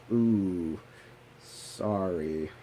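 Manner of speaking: drawn-out imitated "ooh, sorry"; awkward sympathy. Acquaintance realizing he didn't graduate, awkwardly apologetic. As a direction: let it sink down slowly, an awkward apology.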